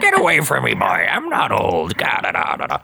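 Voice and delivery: mock-indignant old man, starting high; hammed-up grumpy shooing, dragged out at the end; cranky protest. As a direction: imitate a crotchety cartoon character, trailing into babble. Old Krabs snapping at helper.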